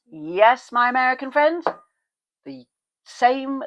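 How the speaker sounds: excited. Real, not acted. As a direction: announce it with glee. Reveals Yale link to Americans.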